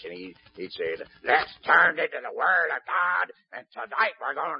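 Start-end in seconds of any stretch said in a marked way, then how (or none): 1.2-4.6 loud, higher, grating preacher voice; raspy radio preacher. Retold or imitated. Imitating a radio preacher for the congregation, loud grating rasp. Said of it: "I'm gonna imitate him".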